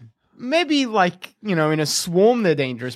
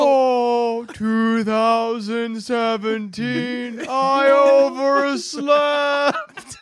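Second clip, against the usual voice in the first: slow groggy mummy; 4 semitones higher, much slower than usual. Acted. Voicing a late mummy.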